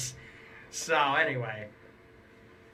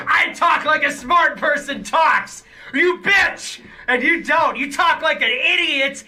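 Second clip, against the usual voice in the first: mock rant; 8 semitones higher, 5 dB louder than usual. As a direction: blow up and yell at them like a smug bully. Mock rival loses temper, yelling.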